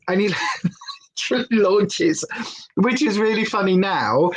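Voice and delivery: ordinary delivery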